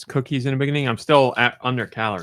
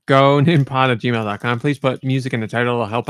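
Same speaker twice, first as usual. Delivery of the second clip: announcer read; 3 dB louder than usual. Acted. Repeating address slowly.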